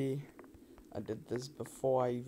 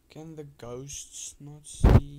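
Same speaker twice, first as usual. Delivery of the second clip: loud puzzled; 11 dB louder, slower than usual. Real, not acted. Sudden loud question in game.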